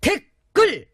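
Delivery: high barked drill call; drill sergeant. Acted.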